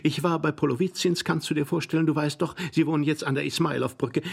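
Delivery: high, gushing lady's voice; excited lady. Acted. Glafira tells husband of her sleigh accident, excited and high. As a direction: pour out the story breathlessly high.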